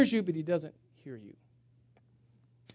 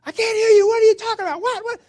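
much higher and louder, yelling into the phone; angry shouting; 12 dB louder than usual. Retold or imitated. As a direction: bellow at a caller you can't hear. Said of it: "And I'm getting kinda angry".

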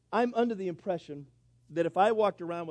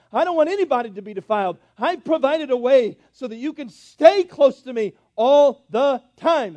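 fervent preaching; 5 semitones higher, 10 dB louder than usual. Acted. Preacher warning the church about sin, loud and fervent. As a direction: lean hard into the warning. Preacher driving home God's words.

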